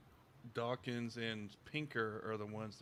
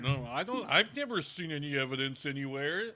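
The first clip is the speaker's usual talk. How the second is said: louder, higher, pretending to be the denier who sees no evidence; sarcastic mock denial; 4 semitones higher, 8 dB louder than usual. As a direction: play dumb, loudly and sarcastically.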